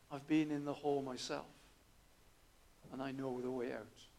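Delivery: low, soft, reassuring reply; calm reassurance. Retold or imitated. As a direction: gently tell him you know the way. Friend calmly reassures the man.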